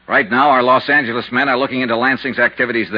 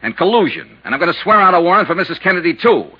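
rising, louder, pitch climbing as he loses patience; angry threat; 7 semitones higher, 3 dB louder than usual. Acted. Detective threatening the broker with arrest, angry and loud. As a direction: slam each threat down harder.

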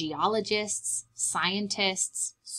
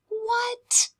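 amazed; 14 semitones higher than usual. Acted. Amazed reaction to wings.

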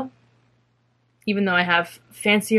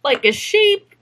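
high, playful cartoonish lilt; jokey voice climbing high at the end; silly put-on voice; 4 semitones higher, 4 dB louder, slower than usual. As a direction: slip into a goofy higher voice. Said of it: "what's that voice?".

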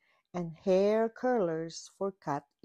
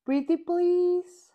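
high pleading voice; 10 semitones higher, 6 dB louder than usual. A narrator's reading. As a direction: beg sweetly. Unicorn pleading with Kitty.

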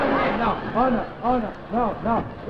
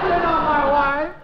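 slow, high, loud cry; horrified denial; 6 semitones higher, 8 dB louder, slower than usual. Acted. Husband shouting at his wife in horror. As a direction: back away in horror.

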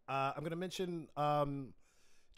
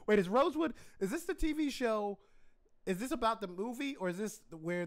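sudden higher, louder double take; surprised; 8 semitones higher, 4 dB louder than usual. Real, not acted. Host realising which show it is, surprised. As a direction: catch yourself mid-question, startled.

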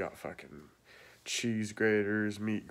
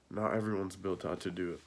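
ordinary talk

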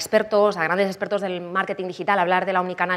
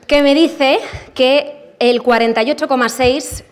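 excited announcing; 4 semitones higher, 6 dB louder, slower than usual. Real, not acted. Excited reading live poll results.

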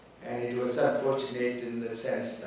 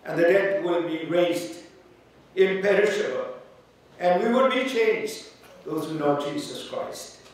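louder, higher preaching at full force; 5 semitones higher, 5 dB louder than usual. Acted. Pastor preaching hope to the grieving family, fervent. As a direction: build the passage into a fervent peak.

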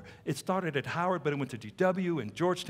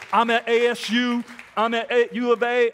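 loud, higher proud announcement; calling out; 5 semitones higher, 6 dB louder than usual. Acted. Future missionaries announcing their campuses, calling out. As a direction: sing out the campus like a roll call.

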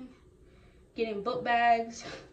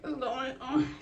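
shocked; 3 semitones higher than usual. Retold or imitated. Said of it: "I was definitely like".

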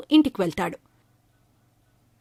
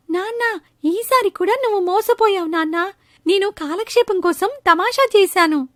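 put-on higher, teasing kid voice; cheeky boy; 8 semitones higher than usual. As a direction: laugh off the prank as a child.

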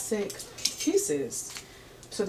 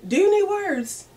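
"you" pushed way higher and louder; high diva squeal; 11 semitones higher, 6 dB louder than usual. Pretend. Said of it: "repeated with higher pitch".

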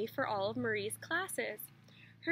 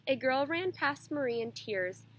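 usual speaking voice

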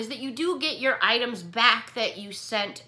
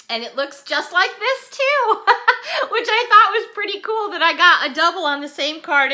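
laughing through the words, pitched up; giggling over a funny card; amused laugh breaking into the sentence; 7 semitones higher, 8 dB louder than usual. Real, not acted.

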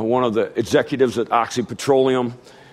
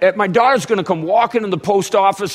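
ordinary talk

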